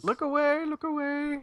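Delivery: high mock squeak, look away; high squeaky voice. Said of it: "super fun".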